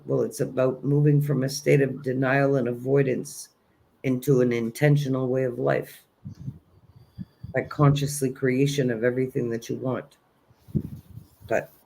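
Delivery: ordinary talk, nothing marked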